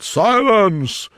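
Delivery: high, loud court order; crying out. A narrator's reading. King silencing the courtroom, crying out. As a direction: call the room to order. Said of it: "he cried out".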